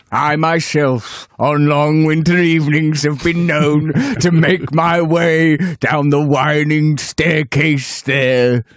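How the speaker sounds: slow, booming wartime-orator impression; ponderous oratory to the monarch; Winston Churchill. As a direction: declaim it like a mock-grand old statesman. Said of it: "That's, uh, Churchill".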